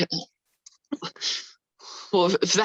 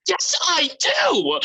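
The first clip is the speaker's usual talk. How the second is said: insistent shout; 7 semitones higher, slower than usual. Acted. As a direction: shout it, pitch jumping up. Lovers arguing, he insists.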